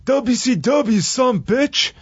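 contained, high-pitched retold holler; imitated yell.

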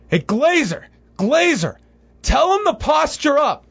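high mock cageside yelling; retold yell. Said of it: "yelling at Woodley".

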